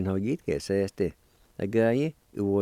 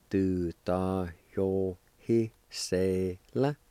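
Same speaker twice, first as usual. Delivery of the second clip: slow, drawn-out syllables with short gaps; measured teaching pronunciation; slow pronunciation demonstration; much slower than usual. Shown as a demonstration. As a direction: sound it out slowly, piece by piece. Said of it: "It's pronounced like this".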